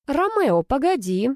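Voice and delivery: high-pitched call to wait. A narrator's reading. Sister stopping her brother as he leaves, calling out. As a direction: call after him in a lifted voice.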